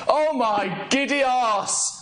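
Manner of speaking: delighted exclamation. Real, not acted. Streamer stunned by the score, slow delighted cry. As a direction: stretch it out in glee. Goal celebration.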